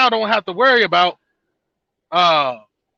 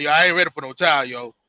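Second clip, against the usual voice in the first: much lower and fast, brushing it off; dismissive man; 8 semitones lower, much faster than usual. Retold or imitated. Man told she's pregnant, low and fast brush-off. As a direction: rattle off a careless guy backing out. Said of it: "and he be like".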